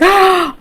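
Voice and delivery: a sudden high gasp of shock; sharp gasp. Retold or imitated. Hearing her gown is safe, a sharp high gasp. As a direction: gasp in disbelief at the news.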